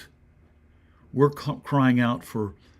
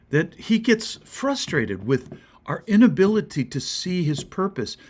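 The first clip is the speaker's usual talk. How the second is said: ordinary talk, nothing marked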